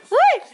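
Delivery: surprised squeal. Real, not acted. Surpresa com o brilho.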